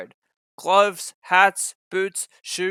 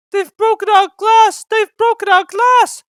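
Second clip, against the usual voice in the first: much higher, loud wailing; sobbing coaches; 10 dB louder than usual. A narrator's reading. Coaches wailing over their broken windows. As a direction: wail like weeping coaches. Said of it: "sobbed the coaches".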